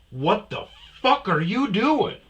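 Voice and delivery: high, drawn-out indignant outburst; angry confrontation. Retold or imitated. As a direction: confront him loudly, voice climbing. Acted confrontation in parking lot.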